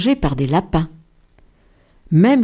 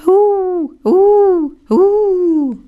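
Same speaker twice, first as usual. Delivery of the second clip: high, louder mock wolf howl; higher, louder pretend howling; 9 semitones higher, 4 dB louder, much slower than usual. A narrator's reading.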